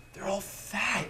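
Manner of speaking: put-on high, blunt child voice; a kid stating the obvious; little kid voice. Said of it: "And the little kid goes".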